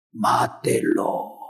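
slow, eerie whisper; sinister slow whisper. Acted. The midnight voice urging murder, slow whisper. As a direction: breathe it slowly like a demon. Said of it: "habla medianoche".

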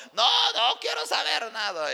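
high, loud mock outburst; angry shouting. Husband rejecting the pastor's goodbye, angry shout. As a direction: cut him off with an angry yell.

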